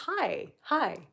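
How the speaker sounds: ordinary delivery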